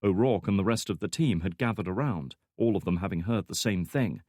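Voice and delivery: usual speaking voice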